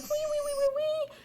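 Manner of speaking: very high squeaky violin imitation, hummed; violin squeak. Storyteller imitates Ronaldinho playing violin, high violin squeaks. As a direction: mimic a violin's thin high notes. Hero plays violin in woods.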